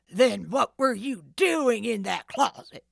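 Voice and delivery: loud gruff demand. Acted. The thief interrogates Nancy, loud demanding voice. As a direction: press her hard for an answer. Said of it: "the thief demanded".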